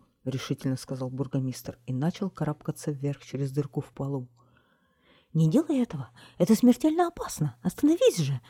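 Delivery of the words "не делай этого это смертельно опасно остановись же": high, louder desperate pleading; pleading cry. A narrator's reading. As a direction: beg him to stop, rising higher. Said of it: "умоляла вдова". (отчаянная громкая мольба)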